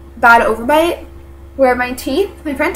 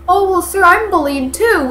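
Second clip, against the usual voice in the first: higher-pitched mocking imitation of a whiny excuse; mock pleading bully; 7 semitones higher than usual. Imitating the bully pleading to the principal, mock pleading. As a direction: put on a high, fake victim voice.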